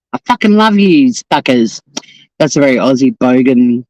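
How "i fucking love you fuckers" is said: usual speaking voice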